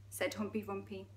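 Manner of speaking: usual speaking voice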